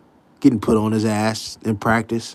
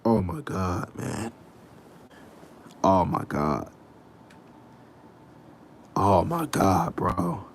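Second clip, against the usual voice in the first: low, soft, drawn-out dismay; under-your-breath disbelief; hushed disbelief; 7 semitones lower, 8 dB quieter, slower than usual. Real, not acted. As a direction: say it quietly, stunned and slow. Disbelief at the beating he watched.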